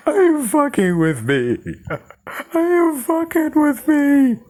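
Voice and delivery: high hammed-up tearful wail; crying yell, imitated. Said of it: "as she's crying, she yells out".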